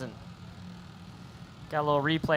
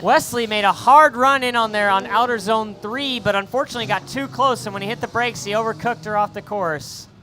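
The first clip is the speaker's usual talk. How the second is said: raised, driving race commentary; energetic announcer recap, pitched up; animated play-by-play; 6 semitones higher, 8 dB louder than usual. Real, not acted. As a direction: explain the crash with the adrenaline still up. Live play-by-play after crash.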